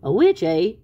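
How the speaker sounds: loud, doubting question; sceptical echo. Acted. Sylvester doubting the tiny witch, loud sceptical question. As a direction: throw it back loudly, unimpressed.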